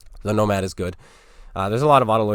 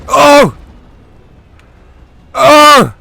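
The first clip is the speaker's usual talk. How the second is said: ecstatic screaming, much higher and louder; shrieking jackpot winner; 16 dB louder, much slower than usual. Real, not acted. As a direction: scream like you just won everything. Winning the match, screaming in joy.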